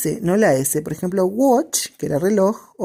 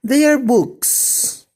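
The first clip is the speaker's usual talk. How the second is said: slow model sentence; 7 semitones higher, much slower than usual. Shown as a demonstration. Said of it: "En el plural vamos a usar their".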